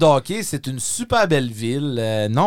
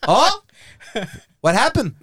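high-pitched mock surprise; playing innocent; 7 semitones higher than usual. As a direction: act as if you know nothing, pitched up. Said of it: "J'ai joué la carte de l'innocence". (fausse innocence, ton aigu et étonné)

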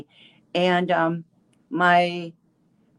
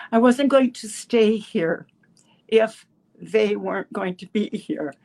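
emotional; 3 semitones higher than usual. Real, not acted. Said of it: "I'm getting, um, a little emotional".

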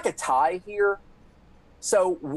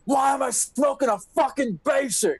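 stoned friend; 8 semitones higher than usual. Retold or imitated. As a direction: play the baked friend complaining about his cigarette. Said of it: "you're all like".

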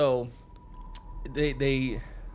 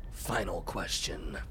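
slow menacing quote; 5 semitones lower, 4 dB quieter, much slower than usual. Retold or imitated. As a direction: lower and slow, a touch of menace. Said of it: "he's like".

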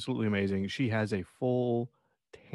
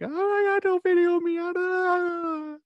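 mock hysterical shriek, much higher and louder; hammed-up panicked wail; hysterical Karen; 5 dB louder, slower than usual. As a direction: squeal it like a woman melting down on camera. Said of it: "crying hysterically and just being like".

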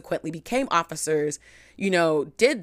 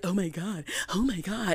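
hushed shock; 8 dB quieter than usual. Retold or imitated. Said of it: "look at it and be like".

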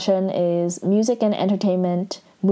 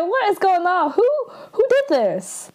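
shocked disbelief; 12 semitones higher than usual. Retold or imitated. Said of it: "She's like".

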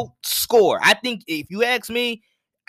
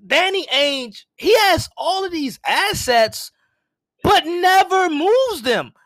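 high-pitched exasperation, drawn out toward the end; exasperated complaint; 9 semitones higher, slower than usual. Retold or imitated. Sports host griping to Celtics fans about the team boss, exasperated. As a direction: air a long-held grievance, voice pitched right up.

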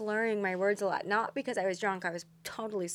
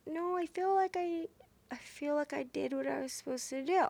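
high-pitched, soft plaintive protest; hurt, wavering insistence; plaintive self-defence; 6 semitones higher, 7 dB quieter than usual. Retold or imitated. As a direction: plead your case in a small, high voice. Recounting own protest about being left out.

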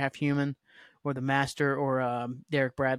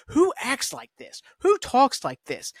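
higher, drawn-out disbelief; exasperated; 8 semitones higher, 4 dB louder, slower than usual. Real, not acted. Reviewer ranting about the worst character, exasperated. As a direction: throw up your hands at the character.

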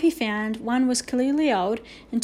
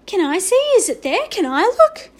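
high, loud, eager clamour; clamouring children; 9 semitones higher, 9 dB louder than usual. A narrator's reading. Children begging the teacher for a look, clamouring. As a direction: pipe up like excited kids all begging. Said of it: "clamoured the other children".

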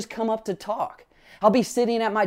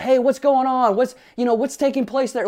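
put-on higher, louder call to the kids downstairs; retold yell; 5 semitones higher, 4 dB louder than usual. Dad calling to his crying kids, retold yell. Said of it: "I'll just yell out".

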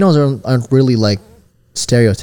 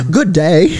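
mock British greeting, pitched up and laughing; British estate agent; 5 semitones higher, 4 dB louder than usual. Host imagining friend as British real estate agent, hammy British. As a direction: put on a jokey British accent. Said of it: "Imagine Kyle as a British real estate agent".

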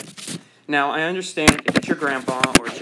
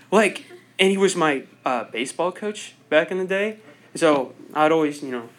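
ordinary delivery

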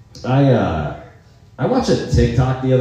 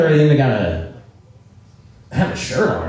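ordinary talk, nothing marked

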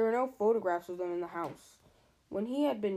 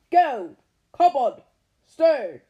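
choked furious bark; too angry to get words out; strangled voice; 4 semitones higher, 15 dB louder, much slower than usual. Acted. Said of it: "so angry he could hardly speak".

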